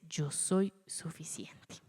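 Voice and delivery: slow whisper; hushed divine reassurance. Acted. God answering Moses' doubt in a slow whisper. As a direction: breathe it slowly as quiet assurance. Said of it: "pero Dios dice".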